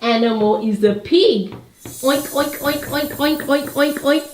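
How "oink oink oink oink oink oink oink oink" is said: rapid string of little oinks; a higher, repeated pig noise. Shown as a demonstration. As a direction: imitate a pig oinking for a small child. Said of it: "They make that noise".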